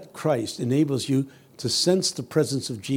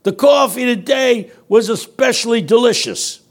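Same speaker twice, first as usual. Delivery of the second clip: high, loud, near-shouted exclamation; homeless man, delighted; 7 semitones higher, 5 dB louder than usual. Retold or imitated. The homeless man explains his generosity, high and shouting. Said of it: "He said".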